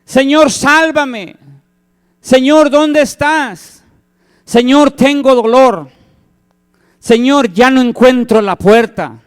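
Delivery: anguished prayer; pleading cry to God. Retold or imitated. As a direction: beg the Lord for rescue. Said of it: "Empiezas a clamar a Dios".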